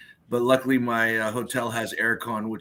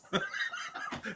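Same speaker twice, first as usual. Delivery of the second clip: soft airy laugh; quiet unvoiced chuckle; breathy laugh; 9 semitones higher, 10 dB quieter than usual. Real, not acted. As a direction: laugh under your breath at yourself. Laughs at own bad accent.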